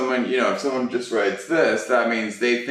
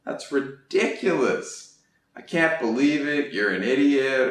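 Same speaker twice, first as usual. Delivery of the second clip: high, disbelieving outburst; stunned disbelief; 5 semitones higher than usual. Shown as a demonstration. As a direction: sound stunned and indignant. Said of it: "sort of feeling".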